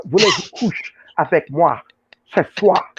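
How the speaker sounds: jokey smooth-talker French; mock French pickup line. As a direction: play a cheesy French seducer for laughs. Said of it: "I talk French too".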